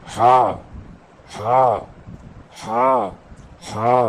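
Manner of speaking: Arabic हा from the middle of the throat, said loud and hard; हलक के बीच से सख्त हा; throaty ha. Shown as a demonstration. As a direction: push हा out of the middle of the throat with effort. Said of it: "ऐसे पढ़ते हैं इसको".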